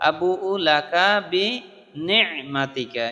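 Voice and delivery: আবু'ু লাকা বিনি'মাতিকা with the আইন from the throat, slow; throat sound. Shown as a demonstration. Teacher shows the আইন sound from the throat, slow. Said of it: "একটু গলার মধ্যে থেকে হবে".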